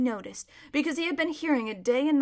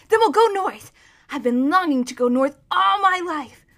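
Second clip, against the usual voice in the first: high, loud, eager; elated, raised voice; excited boy; 6 semitones higher, 9 dB louder than usual. A narrator's reading. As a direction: gush with longing for the journey. Boy cheers the escape plan.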